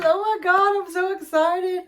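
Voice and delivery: high, loud and thrilled; excited squeal. Real, not acted. Unboxing a friend's gift, she squeals with excitement.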